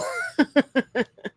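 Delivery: high amused laugh; real laugh. Real, not acted. Host amused by the horrible brunch report, real laughter.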